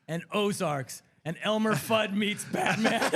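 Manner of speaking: slow, loud trailer announcer; movie trailer voice. Pretend. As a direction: boom it like a blockbuster poster. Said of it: "I could always see the posters".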